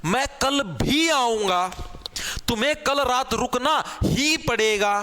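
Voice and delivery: भी and ही stressed, slow; stress on particles. Shown as a demonstration. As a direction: lean hard on भी and ही. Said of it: "जोर डाले". (भी और ही पर ज़ोर)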